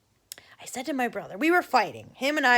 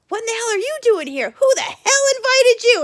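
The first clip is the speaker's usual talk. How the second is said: high indignant shout, growing louder; mock angry; 11 semitones higher, 7 dB louder than usual. Brother scaring off the girl at the door, mock angry. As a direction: imitate an outraged kid, building in volume.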